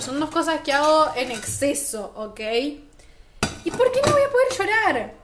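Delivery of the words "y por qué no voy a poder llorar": high, loud, exasperated question; indignant outburst. Real, not acted. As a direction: demand it, up high and louder. (pregunta indignada, aguda y fuerte)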